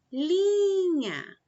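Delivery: linha with a long, loud li; drawn-out LI in linha, then drop off; stress on first syllable. Shown as a demonstration. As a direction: stretch the li of linha high and loud. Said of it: "o li é a mais forte".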